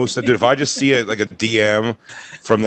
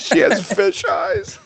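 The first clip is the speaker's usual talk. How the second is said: much higher and very slow, teasing; mock high drawl; much slower than usual. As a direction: stretch it out in a high silly voice. Joking, far higher and very slow.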